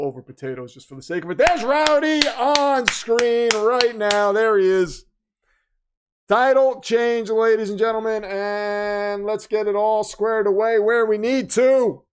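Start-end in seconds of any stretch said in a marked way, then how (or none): 1.4-12.0 hype announcer. Acted. Announces Rowdy on screen.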